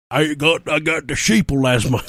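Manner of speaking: whiny put-on excuse voice; mocking someone who won't mask; mock anti-masker. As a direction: sneer it like a maskless whiner.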